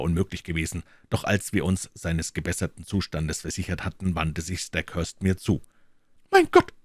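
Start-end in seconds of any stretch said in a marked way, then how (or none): 6.3-6.7 high, loud shocked exclamation; appalled cry at the sight; horrified cry. A narrator's reading. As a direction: cry out in horror. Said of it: "rief er".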